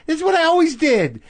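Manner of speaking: high-pitched, indignant outburst; exasperated protest. Retold or imitated. Comic answering fans who doubt his stand-up, exasperated. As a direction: protest loudly to the doubters, voice shooting up.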